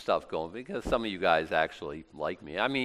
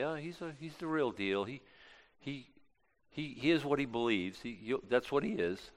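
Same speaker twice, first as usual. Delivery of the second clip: soft, halting concession; grudging admission; 3 semitones higher, 6 dB quieter than usual. Acted. Critics admitting a believer is genuine, mildly grudging. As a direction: concede it reluctantly, stumbling a little. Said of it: "Some people will grudgingly say".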